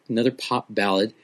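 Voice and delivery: usual speaking voice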